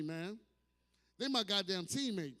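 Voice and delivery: ordinary talk, nothing marked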